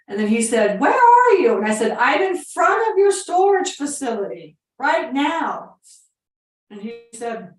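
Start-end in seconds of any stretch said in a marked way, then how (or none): 0.8-1.6 high shouted yeah down the phone; deaf old man screaming. Retold or imitated. Deaf old owner answering his phone, shouting. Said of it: "He was screaming". 1.9-5.8 loud, high and slow, spelling it out; slow shouted offer. Retold or imitated. Caller slowly shouting her offer at a deaf owner. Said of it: "And so I started screaming".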